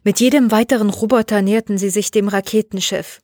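usual speaking voice

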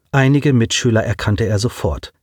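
usual speaking voice

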